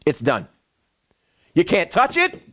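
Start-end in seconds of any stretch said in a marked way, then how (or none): 0.0-0.5 low, certain "It's done"; calm confident declaration. Shown as a demonstration. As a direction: lower and settled, the matter closed. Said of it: "and simply say".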